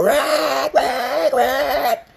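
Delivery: high wyvern screech; imitated monster screech. Player imitates the wyvern flying overhead, high screech. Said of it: "heard some loud screech".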